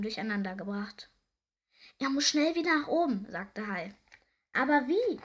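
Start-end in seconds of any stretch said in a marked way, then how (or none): 2.0-3.3 raised, higher storybook voice; loud, concerned urging; urgent. A narrator's reading. Shark urges rescue of sick doctor. 4.5-5.2 loud, high, puzzled exclamation; raised voice, at a loss; urgent question. A narrator's reading.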